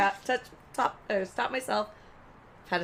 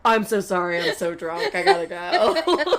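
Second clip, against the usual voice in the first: mock drunk fluster; 8 dB louder than usual. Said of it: "he's like".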